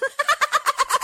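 high, loud burst of laughter; real laugh. Real, not acted. Admitting to murder shows before bed, laughing loudly. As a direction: crack up helplessly. Laughs at grim viewing choice.

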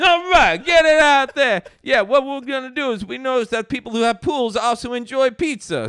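high, loud mock Italian-American businessman; Vinny Pacino. Pizzeria boss pitching pool service to Mike, loud Italian caricature. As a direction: shout like a hammed-up pizzeria boss. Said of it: "this is Vinny Pacino's Pools and Tools and Pizzeria".

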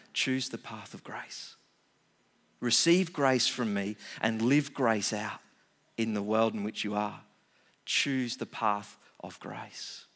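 soft, low, slowing invitation; gentle divine voice. Acted. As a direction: coax them toward grace tenderly. God speaking to the listener.

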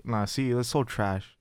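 usual speaking voice

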